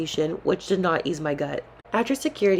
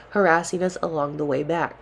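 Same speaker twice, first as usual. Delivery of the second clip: ordinary delivery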